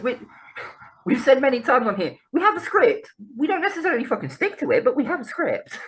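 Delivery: high, comically insistent protest; mock indignation. Real, not acted. Bickering over having a script.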